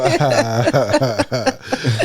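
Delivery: low seductive chuckle; a slow low flirty laugh; sexy laugh. Pretend. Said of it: "do your sexy laugh".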